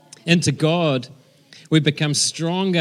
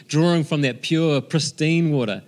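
usual speaking voice

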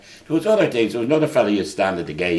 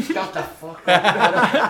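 a higher, slow, laughing "shut the fuck up"; mock angry retort; 10 semitones higher, much slower than usual. Player taking a throw retorts to a heckler, mock angry. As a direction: snap back at a heckler, half laughing.

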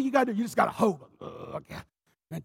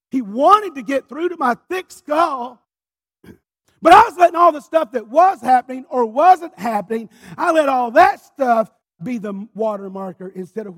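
forceful preaching; 7 semitones higher, 15 dB louder than usual. Acted. Pastor drives home his point, preaching loud.

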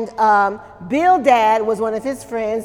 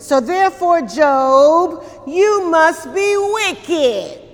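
higher and slow, ending in a shout; accusing shout; 5 semitones higher, slower than usual. Retold or imitated. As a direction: hammer the verdict home. Bildad accusing Job.

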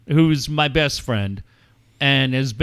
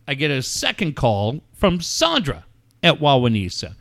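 ordinary talk

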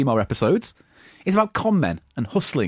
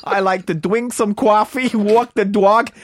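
loud, slow, overdone Long Island accent with coffee as kwarfee; overplayed Long Island accent; 6 semitones higher, 4 dB louder, slower than usual. Shown as a demonstration. Host's second take, overplaying a Long Island accent for friends. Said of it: "You're overplaying it there".

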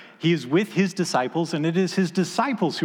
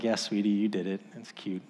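tender hush; 5 semitones lower, 6 dB quieter than usual. Retold or imitated. Father humoring proud daughter.

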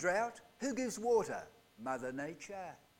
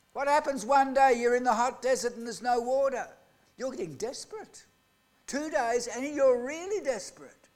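ordinary talk, nothing marked

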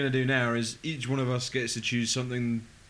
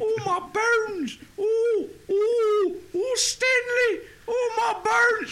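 slow, very high, louder granny wail; grandma impression; 5 dB louder, much slower than usual. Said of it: "doing an impression of my gran".

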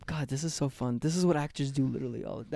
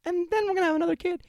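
nagging wife; 14 semitones higher, 4 dB louder than usual. Acted. Plays nagging wife in improv.

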